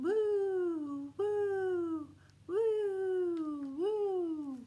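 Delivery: high sung woos like an echo; a playful echo for the kids; echoing train whistle. Acted. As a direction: let the whistle echo through the tunnel.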